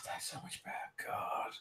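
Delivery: breathless whisper of relief after feeding; whispered bliss of a fed vampire; ecstatic whisper. Acted. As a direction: whisper it like the blood just hit. Cassandra savouring blood.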